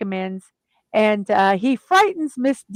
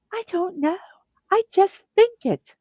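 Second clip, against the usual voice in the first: [name]; 8 semitones higher than usual. Retold or imitated. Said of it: "let me see if I can do a [name] voice".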